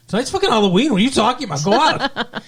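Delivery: high-pitched, laughing mock outburst; sudden exclamation. TA suddenly reverses course and tells Buffy to party, exclaiming.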